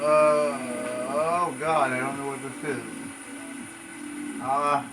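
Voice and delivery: ordinary delivery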